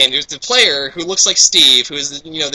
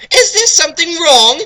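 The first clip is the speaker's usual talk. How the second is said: put-on squeaky, loud worried girl; oblivious girl in falsetto; high girl voice; 13 semitones higher, 6 dB louder than usual. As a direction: ask innocently in falsetto. Voicing Knights, high falsetto.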